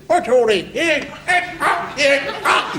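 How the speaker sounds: very high, strangled outraged yell; high incoherent sergeant major shriek; apoplectic sergeant major. Retold or imitated. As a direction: splutter like a furious sergeant major. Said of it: "was absolutely, he said".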